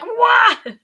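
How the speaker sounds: high, loud startled yelp; sudden loud burst of astonishment; startled whoa. Retold or imitated. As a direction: blurt it out in mock shock. Acting out receiving the baby.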